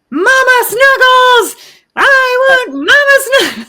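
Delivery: put-on high, slow, loud little child's wail; mock toddler scream from the crib. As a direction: wail like a two-year-old calling for mom at night. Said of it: "she would scream".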